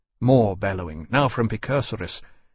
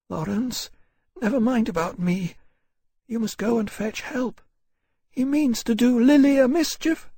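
put-on higher, pleading young woman's voice; young woman, hurt and urgent; 12 semitones higher than usual. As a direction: urge him to go, hurt but brave. Harcourt, hurt, urges Lawrence.

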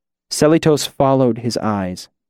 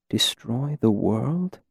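softly to himself; 7 dB quieter, slower than usual. Acted. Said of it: "Selitos said softly to himself".

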